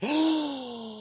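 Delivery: high, hammed-up crowd gasp; mock gasp. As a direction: gasp like a shocked courtroom. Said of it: "everybody goes".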